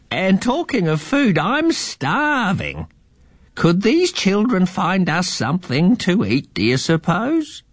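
put-on high, slow old eagle voice; lazily agreeing old bird man. A narrator's reading. The old eagle agrees with Fred the mouse, slow and high.

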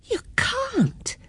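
put-on very high, soft child's protest; high squeak of disbelief; disbelieving child. A narrator's reading. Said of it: "couldn't believe her ears".

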